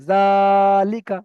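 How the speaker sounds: "জালিকা" with a loud, very long jaa, short lika; drawn-out syllable. Shown as a demonstration. Teacher shows students the stretch, long jaa in "জালিকা". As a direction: hold jaa in "জালিকা" steady, then release. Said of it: "জাটাকে আমাকে টান করতে হবে".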